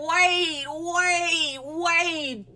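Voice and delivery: acting out stunned disbelief; retold stunned wait. Vlogger retelling her reaction to fan drama, stunned. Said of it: "'cause I said".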